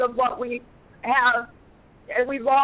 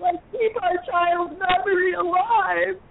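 crying; 10 semitones higher than usual. Real, not acted. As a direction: voice breaking into tears, much higher. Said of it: "it makes me wanna cry".

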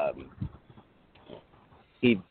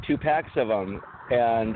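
ordinary talk

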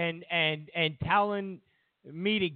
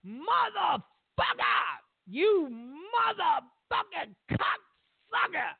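much higher shrieking yell, stretched out; street woman screaming; 11 semitones higher, slower than usual. Retold or imitated. Comedian imitates barefoot street woman yelling at passersby, shrieking. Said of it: "And she screams".

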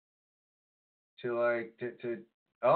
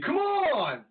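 high, petulant fan complaint; mock whining, pitched way up; whiny internet fan; 7 semitones higher than usual. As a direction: gripe like an outraged online wrestling nerd. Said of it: "can I be like the I W C".

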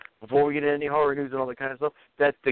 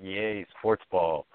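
sarcastic cheer; 7 semitones lower, 3 dB quieter, much slower than usual. Retold or imitated. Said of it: "you'd be like".

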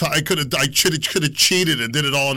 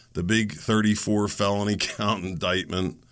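ordinary delivery

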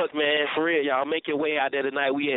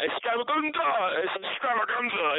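playful voices; 7 semitones higher than usual. Pretend. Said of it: "any voice you wanna make it".

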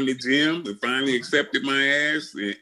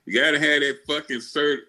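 ordinary talk, nothing marked

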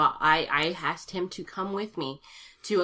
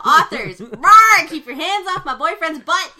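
playful roaring shout, much higher and louder; bouncy playful with animal noises; 12 semitones higher, 14 dB louder, slower than usual. Pretend. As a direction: growl and yell a jealous warning. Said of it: "you really spiked the sound system".

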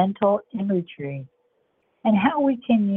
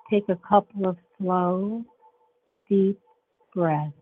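guided meditation; 5 dB quieter than usual. Acted.

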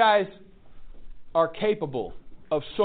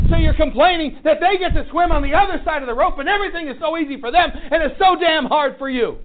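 fiery preaching; 8 semitones higher, 9 dB louder than usual. Acted. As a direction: drive it home to the room. Preaching at full force.

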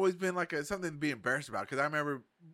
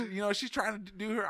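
ordinary delivery

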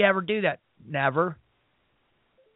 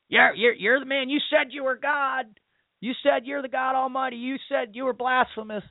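raised, jeering voice throwing accusations; mocking taunt; 6 semitones higher than usual. Crowd jeering at Jesus on the cross, mocking. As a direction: taunt him like a sneering crowd.

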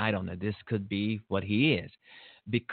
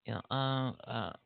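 usual speaking voice

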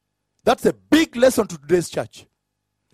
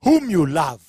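"Whom you love" set apart, weight on "Whom"; emphatic stress; 5 semitones lower than usual. Shown as a demonstration. Preacher underlining God's words, emphatic "Whom you love". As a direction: press on "Whom" and let "love" fall away. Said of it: "I always like to emphasize".

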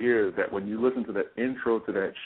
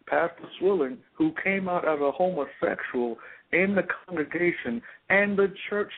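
rising, much higher fervent sermon voice; impassioned preaching; 8 semitones higher than usual. Acted. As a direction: preach it with mounting fire. Preaching rises to full force.

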